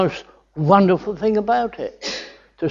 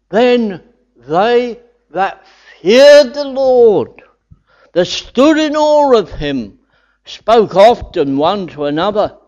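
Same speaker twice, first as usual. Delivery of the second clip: slow, raised reading of the verse; scripture reading; 5 semitones higher, 6 dB louder, slower than usual. Acted. Preacher reads Malachi aloud to congregation, slow and raised. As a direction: proclaim the verse slowly to the congregation.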